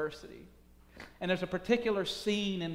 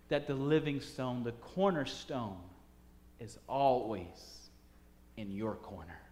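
reflective sermon close; 3 semitones lower than usual. Real, not acted. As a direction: lay it down slowly and gently. Slow preaching close.

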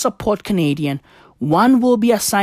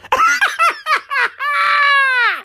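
high laugh close to tears; high cackling laugh. Real, not acted. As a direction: crack up at your own joke. Cracks up at own bit.

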